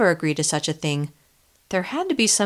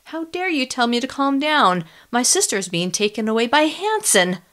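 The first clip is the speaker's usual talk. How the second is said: rising angry outburst; 6 semitones higher than usual. Acted. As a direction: let the volume climb in anger. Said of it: "the volume of my voice rose".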